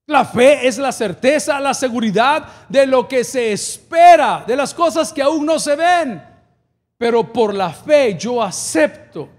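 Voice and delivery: slow, stretched proclamation; emphatic preaching. Real, not acted. Pastor proclaiming faith from scripture, slow emphatic preaching. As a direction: declare it slowly and firmly to the church.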